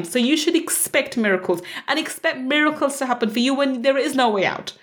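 usual speaking voice

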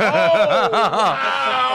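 high, loud, half-laughing amazement; amazed. Retold or imitated. Retelling seeing him down on one knee, amazed. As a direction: replay the shock loud and high. Retold reaction to him kneeling.

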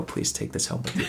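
hushed whispered plea; whispering. Retold or imitated. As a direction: whisper it quickly, half under breath. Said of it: "and I go".

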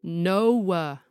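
put-on whiny kid's "No" stretched to "no-oo-uh"; tortured triphthong. Kid refusing a parent, tortured triphthong "No". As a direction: loudly drag "No" through three vowels. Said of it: "tortured into a whole triphthong".

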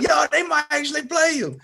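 very high, hyped-up buddy voice; excited friend. Retold or imitated. Speedy whispering to him on the bench, high and thrilled. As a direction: squeal it like an overexcited pal.

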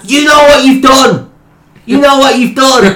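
put-on high, loud Birmingham accent accusing; mock Birmingham boss, menacing repeat; Birmingham manager. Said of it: "He's like".

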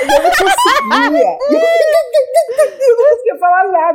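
very high, slow mock wail; imitated crying. Actor retelling failing to speak to her idol, hammed-up sobbing. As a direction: act out being too choked with tears to speak. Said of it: "e eu comecei a chorar".

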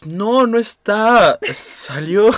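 put-on a kid covering for mom; child lying on phone. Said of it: "Y tú así".

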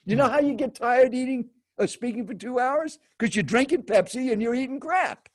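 high, insistent mock lecture; imitated scolding verdict. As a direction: drive the point home as the pushy seatmate. Acted stranger delivering his scolding verdict.

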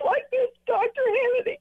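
mock tearful whine pitched high; phony crying. Caller hams up crying to the host, mock tearful. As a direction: fake a weepy voice, hammed-up. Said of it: "I'll do the phony crying".

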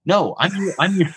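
amazed he calls you a friend; incredulous protest. Retold or imitated. Guard taking selfie with prisoner.

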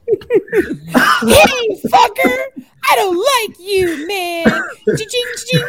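Jim Cornette. Retold or imitated. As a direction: rant like a squeaky wrestling manager. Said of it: "You sound like Jim Cornette".

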